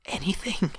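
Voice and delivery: high, rasping whispered question; hoarse whisper. Acted. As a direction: barely breathe it out, hopeless. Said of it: "added in a hoarse whisper".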